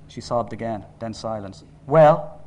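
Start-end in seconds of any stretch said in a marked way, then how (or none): 1.9-2.4 loud, insistent demand. A narrator's reading. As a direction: press her hard for an answer. Father pressing louder.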